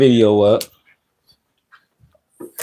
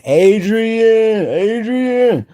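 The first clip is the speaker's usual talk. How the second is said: much higher, drawn-out name call; sing-song call; 11 semitones higher, much slower than usual. Real, not acted. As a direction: sing the name out to greet him. Calling out a viewer's name.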